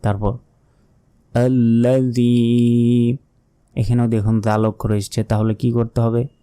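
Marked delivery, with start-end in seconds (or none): 1.3-3.2 আল্লাযী with "dhii" held very long; tongue-out "th" as in "this" in আল্লাযী; slow recitation, stretching the end of আল্লাযী; long final vowel. Shown as a demonstration. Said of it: "তিন second টেনে পড়তে হবে".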